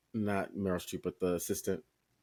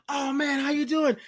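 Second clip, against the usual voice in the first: happy greeting; 4 dB louder than usual. Retold or imitated. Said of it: "He was like".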